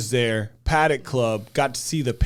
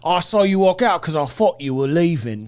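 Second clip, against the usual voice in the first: mock Cockney, a bit loud; Cockney bouncer; 3 semitones higher, 5 dB louder than usual. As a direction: answer back like a Cockney bouncer. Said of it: "Cockney British".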